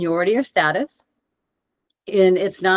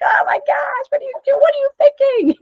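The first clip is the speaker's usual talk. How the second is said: put-on high, loud frustrated yell at a teenager; mock exasperated shout, pitched way up; 4 dB louder than usual. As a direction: coach losing patience with a player, high exasperated yell. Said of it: "'cause we get frustrated".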